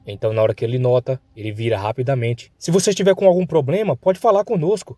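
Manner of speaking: worried mother questioning her son; worried parents. Acted. (pais preocupados, voz aguda)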